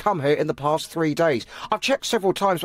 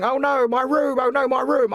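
higher-pitched mock wail of a distraught customer; retold shouting; 5 semitones higher than usual. Clerk retelling a Thai customer's outburst, mock shouting. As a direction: imitate an upset guest without really shouting. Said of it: "He was shouting and saying".

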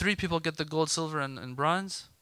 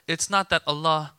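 ordinary talk

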